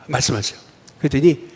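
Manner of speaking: ordinary talk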